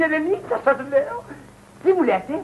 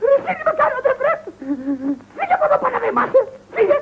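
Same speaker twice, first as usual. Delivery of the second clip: very high, outraged cry; furious dismissal; 8 semitones higher than usual. Acted. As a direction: drive them out, voice shooting up. Furiously throwing the relative out. (έξαλλη αγανάκτηση)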